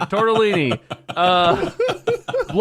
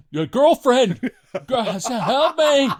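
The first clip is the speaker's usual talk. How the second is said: louder and higher, gasping for help; mock gasping plea; 7 semitones higher, 6 dB louder, slower than usual. Friend imitating a man in pain begging for help, loud and gasping. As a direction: gasp it out like someone collapsing.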